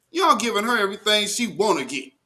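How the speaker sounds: usual speaking voice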